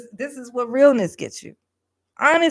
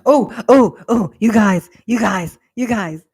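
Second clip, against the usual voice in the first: loud, excited call to the viewers; fading excited outburst; 11 dB louder, slower than usual. Real, not acted. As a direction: grab the chat's attention, buzzing.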